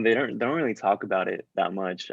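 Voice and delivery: ordinary delivery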